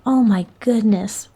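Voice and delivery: plain reading voice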